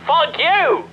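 high-pitched exasperated cursing; angry outburst. Acted. Cursing the player over the cartridge.

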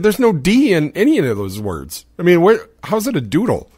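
indignant protest. Retold or imitated. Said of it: "I said".

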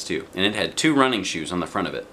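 usual speaking voice

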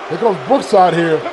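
usual speaking voice

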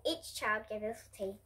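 ordinary delivery

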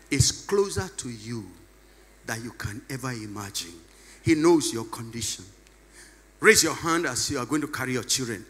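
soft ministry voice. Acted. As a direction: draw them in quietly to imagine.

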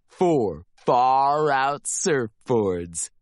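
ordinary delivery, nothing marked

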